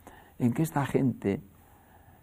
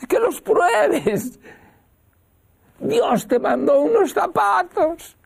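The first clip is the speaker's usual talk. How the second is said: eager urging; 5 dB louder, slower than usual. Retold or imitated. Madre insiste emocionada.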